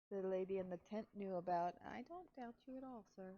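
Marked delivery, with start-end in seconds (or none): none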